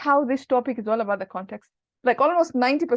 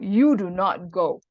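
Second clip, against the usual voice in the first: slow, lower, every word weighted; emphatic forbidding; 4 semitones lower, slower than usual. Shown as a demonstration. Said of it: "it's more emphasis, it's more scary".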